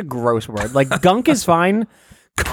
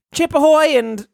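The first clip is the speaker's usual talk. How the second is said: higher, peppy live-reporter announcing; parade reporter voice; 9 semitones higher than usual. Acted. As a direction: hype up the parade like a small-town radio host. Continues reporter bit.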